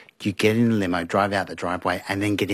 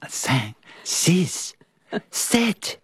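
counting in French, pitch climbing high on sept; mild French counting, rising; 11 semitones higher, much slower than usual. Retold or imitated. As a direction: count up like an excited French PR man. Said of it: "the French P R guy was going".

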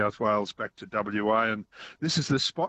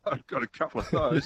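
laughing; 3 semitones higher than usual. Real, not acted. Laughs while answering.

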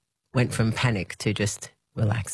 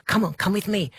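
eager urging; 9 semitones higher than usual. Retold or imitated. Urging dad in the dream.

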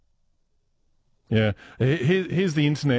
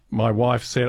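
ordinary delivery

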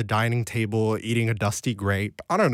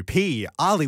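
ordinary talk, nothing marked

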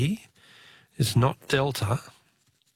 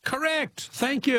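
much higher, delighted "correct"; excited; 11 semitones higher, slower than usual. Real, not acted. Host congratulates the caller, thrilled and high. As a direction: celebrate the right answer. Caller finally gets the answer.

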